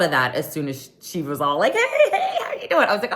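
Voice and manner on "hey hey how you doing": very high, bubbly hey hey; sing-song hello to a crush; mock flirty greeting. Imitating Garcelle greeting flirtily.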